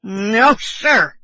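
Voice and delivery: bosun's cried refusal. A narrator's reading. Drunk bosun clinging to the bottle, loud emphatic refusal. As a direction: refuse flat out at full voice. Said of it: "he cried again".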